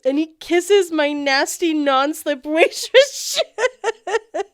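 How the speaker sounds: slow, loud, laughing through it. Real, not acted. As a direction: crack up while telling.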